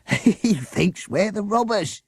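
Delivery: slow, half-laughing splutter; spluttering with laughter. A narrator's reading. Driver to the teacher, spluttering with laughter. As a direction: choke the words out through laughter. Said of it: "he spluttered".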